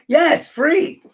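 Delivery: high, rising yes; mock delighted answer. Host tells pretend listener it's free, hammed-up glee. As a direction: sing out the answer playfully. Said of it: "I was acting like I was talking to someone else".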